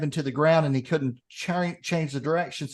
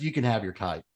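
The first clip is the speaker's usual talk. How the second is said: deadpan punchline; 7 semitones lower, 5 dB quieter than usual. Retold or imitated.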